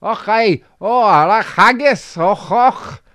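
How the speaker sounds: high, loud, hammed-up Scottish; a terrible stab at Scots, loud and high; bad Scottish accent. Shown as a demonstration. Trying Scottish for a friend who asked, bad hammed-up Scottish accent.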